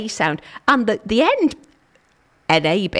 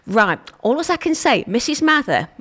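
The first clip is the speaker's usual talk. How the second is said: ordinary talk, nothing marked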